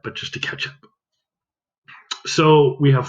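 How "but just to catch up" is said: whispered aside. Real, not acted. Teacher aside, whispered correction.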